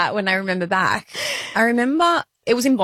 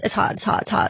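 ordinary delivery

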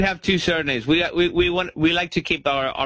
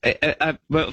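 ordinary talk

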